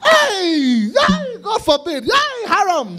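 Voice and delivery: high, loud, drawn-out shouts of protest; horrified parents' outcry. Acted. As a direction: cry out like scandalized parents. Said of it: "What do you think his parents would have done?".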